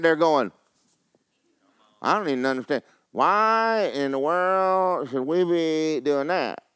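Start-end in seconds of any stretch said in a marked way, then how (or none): 2.0-6.6 slow, drawn-out confused whine; baffled complaint. Retold or imitated. Congregant questioning the command, slow bewildered whine.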